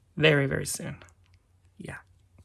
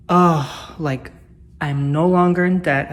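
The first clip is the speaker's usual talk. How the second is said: excited; 8 dB louder, slower than usual. Real, not acted. Celebrating paying off car.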